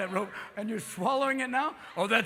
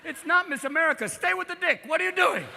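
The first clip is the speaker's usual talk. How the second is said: higher, fed-up scolding; exasperated director; 8 semitones higher than usual. Retold or imitated. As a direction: snap at her to stay on task. Yelling at the porn actress.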